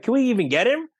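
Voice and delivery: high-pitched incredulous question; retold disbelief. Film team doubting they could land Colin Mochrie, incredulous. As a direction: replay the team's doubt, pitched well up. Retelling team's disbelief at casting idea.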